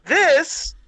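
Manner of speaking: high, slow, showman announcing; dramatic reveal. Pretend. Host unveils the toy's ugly hand, mock-grand announcer. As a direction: unveil it like a movie trailer.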